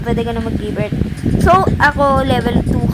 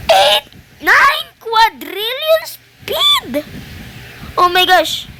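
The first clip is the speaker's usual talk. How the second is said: high, slow, drawn-out squeal of numbers; amazed exclamation; 6 semitones higher, much slower than usual. Real, not acted. Streamer watching the speed counter soar, amazed drawn-out cry. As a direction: stretch each word out in high-pitched amazement.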